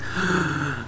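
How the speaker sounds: sharp dramatic intake of breath; mock gasp. Recap of curtain falling, mock gasp. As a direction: gasp in pretend shock.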